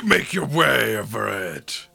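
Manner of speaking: normal talking voice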